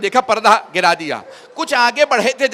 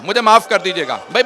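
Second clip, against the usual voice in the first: usual speaking voice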